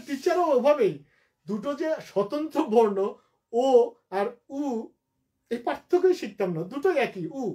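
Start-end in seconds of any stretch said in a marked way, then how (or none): none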